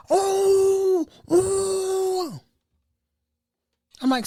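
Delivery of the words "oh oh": high, stretched hooting wail; high drawn-out mock howl; mock screaming. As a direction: imitate a patient howling through his hand. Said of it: "this man screaming his lungs out".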